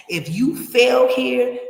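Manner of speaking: preacher-style slow emphasis; emphatic warning. Real, not acted. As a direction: land every word like a warning. Stressing no safety net abroad.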